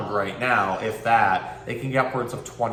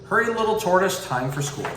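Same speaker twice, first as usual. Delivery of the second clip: put-on lively read-aloud for kids; storybook reading; 7 semitones higher than usual. A narrator's reading. Pet store keeper reads to the tortoise, bright storybook voice.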